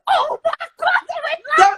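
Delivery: high, loud, thrilled squeal; excited squeal. Retold or imitated. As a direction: shriek it out with delight. Excited reveal of the video.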